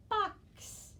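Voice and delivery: box said high in a playful sing-song. Shown as a demonstration. Teacher voicing box high for the class. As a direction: pop the word box up high for children. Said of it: "Say that with me".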